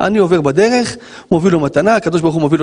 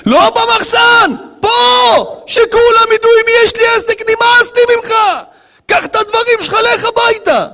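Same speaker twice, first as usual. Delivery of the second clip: furious boss; 4 dB louder than usual. Acted. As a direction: yell it so everyone around hears. Acted boss firing worker in public.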